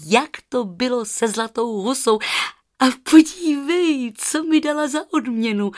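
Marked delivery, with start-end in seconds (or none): 2.8-5.7 put-on higher, louder excited girl; calling out. Rozárka shows the aunt the golden feather, higher excited. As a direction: show off the feather eagerly.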